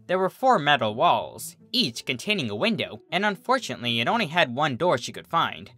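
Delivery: usual speaking voice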